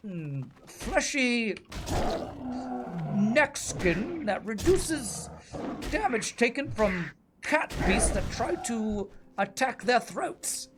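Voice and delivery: high, slow, hushed British nature narrator; David Attenborough impression. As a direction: marvel at the buzzard like a wildlife documentary voice. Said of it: "David Attenborough".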